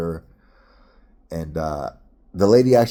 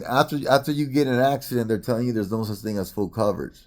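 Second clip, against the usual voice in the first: usual speaking voice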